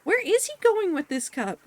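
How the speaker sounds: exasperated disbelief. Real, not acted.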